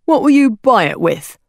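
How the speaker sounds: rubbery man, pointed question; hawker's challenge. A narrator's reading. Hawker asking a penniless girl for payment, challenging. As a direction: put the child on the spot.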